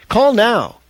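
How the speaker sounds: punchy ad announcer. A narrator's reading. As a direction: sell it like a radio ad. Commercial announcer quote.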